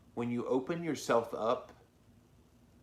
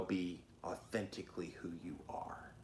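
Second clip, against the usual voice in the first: whispering; 4 semitones lower, 11 dB quieter than usual. Real, not acted. Urging viewers to be authentic.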